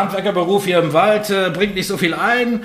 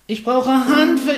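pixie; 10 semitones higher than usual. Acted. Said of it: "Ich bin eine Fee".

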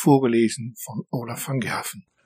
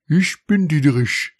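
stranger mouse introducing himself. A narrator's reading. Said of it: "sagte die Maus".

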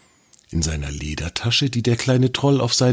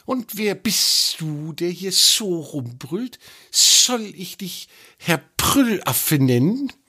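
high, slow snake voice, mocking; teasing snake; 11 semitones higher, slower than usual. A narrator's reading. The snake teases.

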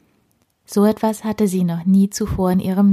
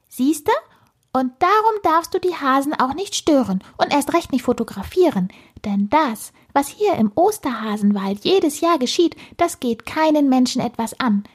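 high-pitched little lecturer; squeaky squirrel voice; 6 semitones higher than usual. A narrator's reading. Squirrel forbids the girl to disturb the bunnies, squeaky. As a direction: admonish in a piping creature voice.

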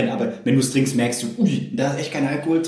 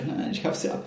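whispering; 8 dB quieter than usual. Retold or imitated. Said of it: "Der hat gesagt".

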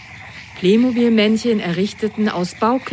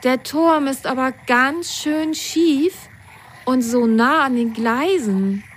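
Lili's voice; 6 semitones higher than usual. A narrator's reading. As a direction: point it out with mild concern. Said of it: "sagte Lili".

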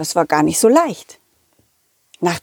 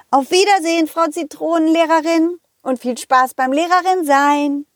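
put-on higher, bright goodbye from the children; children waving off the teacher; lightly voiced happy kids; cheerful kids; 7 semitones higher than usual.

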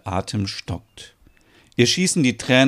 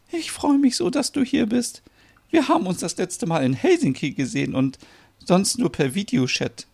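high, overcome, tearful gladness; high-pitched, emotional reunion voice; crying for joy; 10 semitones higher than usual. Acted. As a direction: pour out your joy through tears. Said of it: "Merit weint bitterlich vor Freude".

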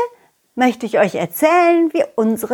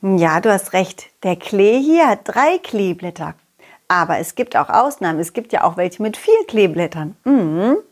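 ordinary delivery

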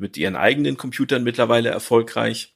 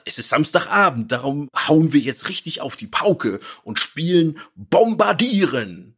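cheery neighbor voice, higher pitched; Ned Flanders; 5 semitones higher than usual. Retold or imitated. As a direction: sound overly chipper and wholesome. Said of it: "Ned sagt". (übertrieben fröhlicher Nachbar)

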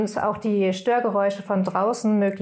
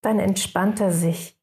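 guided meditation. Acted. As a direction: guide the listeners into rest, unhurried.

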